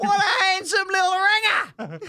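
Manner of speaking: very high mock shriek; hammed-up, piercing swoon; girlfriend impression. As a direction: squeal it in exaggerated admiration. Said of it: "That's what she said".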